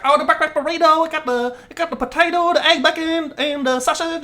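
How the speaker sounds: put-on high, loud Vietnamese-accented lady rattling off the menu; impatient Vietnamese-accented owner listing fillings.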